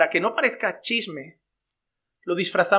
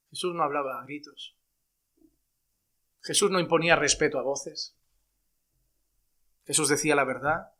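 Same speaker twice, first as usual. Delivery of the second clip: quiet earnest; 4 semitones lower, 5 dB quieter than usual. Acted.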